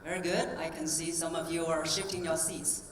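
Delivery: ordinary talk, nothing marked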